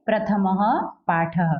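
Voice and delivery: प्रथमः पाठः read slowly, each ः as "ha"; visarga as "ha". Shown as a demonstration. Teacher repeating the title for pupils, slow visarga "ha". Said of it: "तो क्या पढ़ेंगे?".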